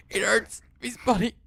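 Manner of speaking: high, rising monstrous plea; inhuman pleading voice. Acted. Creature in the dark begs Koji, high and inhuman. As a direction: beg from somewhere not quite human. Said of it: "not a human voice".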